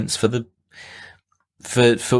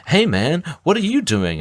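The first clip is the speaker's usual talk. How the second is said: higher-pitched mock friendly rabbit; imagined talking rabbit; 10 semitones higher than usual. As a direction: pipe up like a chatty rabbit.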